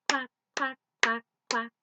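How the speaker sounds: loud sung duck quacks. Acted. Teacher singing mother duck's quacks loud.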